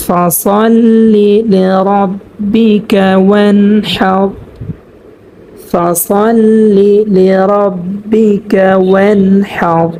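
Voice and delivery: slow, drawn-out tajweed chant; Quran recitation. Shown as a demonstration. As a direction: recite it slowly, stretching the vowels. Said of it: "যেমন".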